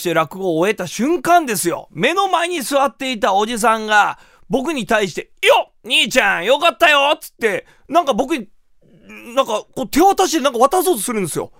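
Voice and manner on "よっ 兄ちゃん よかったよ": lightly voiced hearty older man, higher and louder; audience man calling out. Man in front row hails the rakugo performer, calling out. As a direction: call out praise as an audience member.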